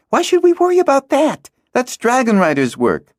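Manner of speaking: put-on a girl dismisses the fight as riders' work; dismissive girl. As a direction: brush it off impatiently. Hala dismisses the danger.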